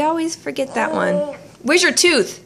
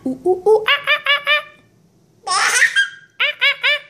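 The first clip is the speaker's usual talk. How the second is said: high ooh ooh ah ah monkey calls, laughing; monkey noises; 11 semitones higher, much faster than usual. Shown as a demonstration. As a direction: hoot like a silly monkey. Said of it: "What does a monkey say?".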